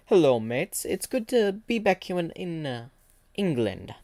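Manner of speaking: put-on lightly done British accent. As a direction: greet them like a cheery Londoner. Clockman greeting back in England.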